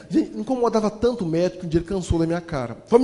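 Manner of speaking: usual speaking voice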